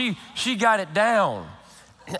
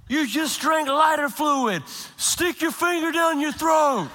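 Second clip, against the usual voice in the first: high, urgent retold plea; husband pleading with wife who swallowed lighter fluid, high and urgent; panicked pleading; 7 semitones higher than usual. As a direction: urge her on in alarm.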